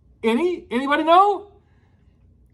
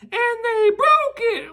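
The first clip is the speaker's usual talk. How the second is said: put-on crying Baby Bear; 11 semitones higher, slower than usual. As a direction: cry out like a heartbroken little bear. Baby Bear finds broken chair.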